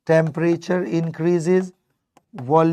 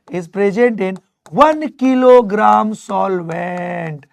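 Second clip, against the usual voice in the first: very slow, one and kilogram pressed; slow stress; 3 semitones higher, 6 dB louder, much slower than usual. Shown as a demonstration. Teacher spelling out definition slowly for students. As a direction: drag it out word by word. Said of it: "teacher stressing definition".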